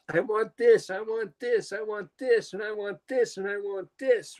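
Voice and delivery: put-on high-pitched, drawn-out kid's demands; singsong chant of a demanding child; whiny-demanding kid. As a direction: imitate a kid begging over and over. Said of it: "the other kid's going".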